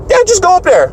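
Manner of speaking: very high-pitched mock host voice; high, offhand imitation of a host; imitated host. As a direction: wave the act onstage in a put-on high voice. Acts host waving him onstage.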